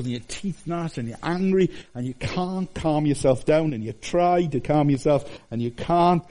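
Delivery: ordinary delivery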